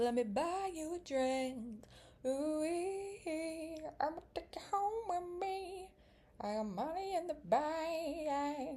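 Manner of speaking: high, slow, showy pickup-line voice; flirty guy.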